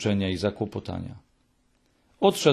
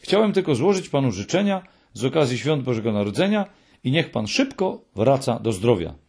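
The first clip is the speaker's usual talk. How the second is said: ordinary delivery, nothing marked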